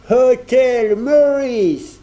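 put-on stiff, solemn Englishman in a thick English accent. A narrator's reading. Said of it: "prit un accent anglais très prononcé et très solennel". (accent anglais très prononcé et solennel, très lent)

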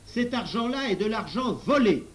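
loud, high public denunciation; loud accusation. A narrator's reading. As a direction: cry out the accusation for all to hear. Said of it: "s'écria le professeur à haute voix".

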